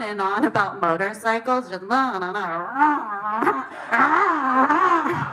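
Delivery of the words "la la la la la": droning la-la motor sound; motorcycle noise imitation. Shown as a demonstration. Mimicking her date's endless motorcycle talk as engine noise. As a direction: hum like a bike engine revving. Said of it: "That's my motorcycle impression".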